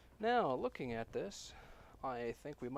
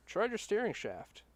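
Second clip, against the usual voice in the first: louder, higher, clearly spelled out; emphatic repeat; 8 semitones higher, 5 dB louder than usual. Real, not acted. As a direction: repeat it so he hears. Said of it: "No, no".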